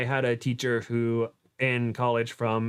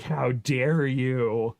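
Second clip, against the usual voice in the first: slow, drawn-out mock indignation; mock outrage; much slower than usual. Imitating offended Japanese speakers in chat, mock outrage. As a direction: stretch it out as if deeply offended. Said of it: "individuals who know Japanese in chat are like".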